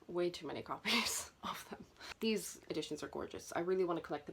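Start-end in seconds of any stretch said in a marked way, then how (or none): 0.1-1.8 whispered with a laugh; guilty whisper. Real, not acted. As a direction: admit it under your breath, laughing. Confessing to too many copies.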